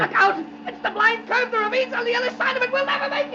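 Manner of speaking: see death coming; mounting terror. Acted. Man watching the car reach the curve, terrified.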